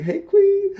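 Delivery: high gushing hello to a coworker; squealy greeting. Retold or imitated. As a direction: greet her like a gushing friend.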